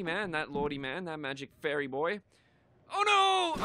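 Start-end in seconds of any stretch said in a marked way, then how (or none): 2.9-3.6 high, loud cry of dismay; alarmed cry. Pretend. Player hurt in the game, high alarmed cry. Game mishap to his legs.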